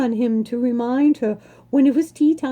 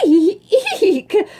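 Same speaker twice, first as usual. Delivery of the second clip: loud, laughing eek eek; startled shriek; 7 semitones higher, 5 dB louder than usual. A narrator's reading. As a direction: squeal like a startled barmaid. Birdie startled by Charlie.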